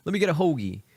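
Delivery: lightly hammed-up Philly accent. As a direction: order like a Philly local. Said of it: "That's, like, Philly, uh, speak for you".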